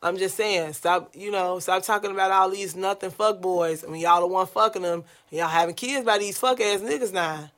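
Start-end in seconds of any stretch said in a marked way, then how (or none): none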